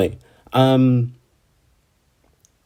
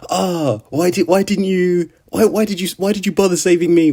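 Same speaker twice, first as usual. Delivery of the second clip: high, pleading, anguished questions, raised pitch; imitating a distraught Subaru; 7 semitones higher than usual. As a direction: plead like a broken-hearted hero. Retold despairing Subaru quote.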